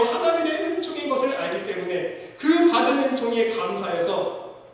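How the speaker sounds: loud, high preacher's voice pressing each phrase; fervent preaching. Acted. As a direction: preach it out with full force to the congregation. Preaching at full force.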